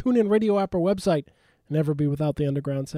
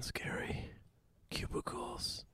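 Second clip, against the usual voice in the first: whispering; 14 semitones higher, 20 dB quieter, slower than usual. Pretend. Spooky whisper about scary book.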